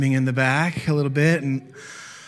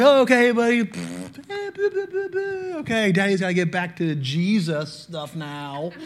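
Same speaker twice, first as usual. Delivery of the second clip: put-on higher, sing-song coaxing to a baby; soothing baby talk; 3 semitones higher, 4 dB quieter than usual. Dad telling his baby he must get back to work, soothing. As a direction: gently hush the little one.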